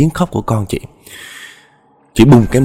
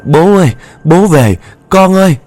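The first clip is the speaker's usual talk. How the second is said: high, loud screaming cries; screaming in sleep; 9 semitones higher, 5 dB louder than usual. Acted. Woman screams for her father-in-law and child in her sleep. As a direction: scream out from a nightmare. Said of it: "Chị mới gào lên như vậy á".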